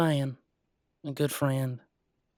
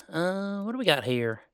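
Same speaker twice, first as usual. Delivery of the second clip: normal talking voice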